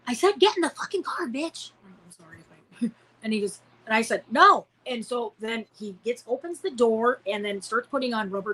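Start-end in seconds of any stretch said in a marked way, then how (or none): 0.1-1.7 much higher, harsh retold threat; the kidnapper ordering the girl into the car, menacing; snarling threat. Kidnapper turns violent. 4.3-4.7 loud, high defiant no; the girl shouting no at the kidnapper; shouted no. Retold or imitated. Child refusing.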